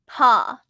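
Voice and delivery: Paw said loudly with an open aw; aw vowel. Shown as a demonstration. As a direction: model the aw in Paw. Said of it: "or paw".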